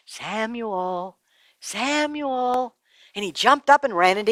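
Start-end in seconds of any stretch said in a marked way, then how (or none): 0.1-2.7 mysterious voice calling. A narrator's reading. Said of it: "he heard the voice again".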